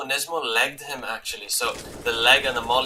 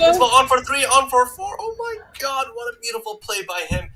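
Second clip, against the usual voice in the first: much higher, loud hype yell; excited shout; 11 semitones higher, 6 dB louder than usual. Real, not acted. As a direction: scream the clutch play out. Caster erupts at clutch kills.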